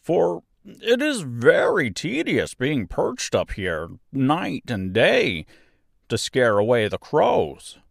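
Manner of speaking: high, slow, loud character voice; simple straw man pleading politely; good-natured scarecrow, weary of his pole. A narrator's reading. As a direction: complain amiably in drawn-out, swooping tones.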